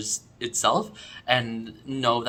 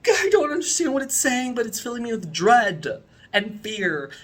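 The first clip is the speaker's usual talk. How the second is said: mock dread; 10 semitones higher, 5 dB louder than usual. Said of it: "and be like".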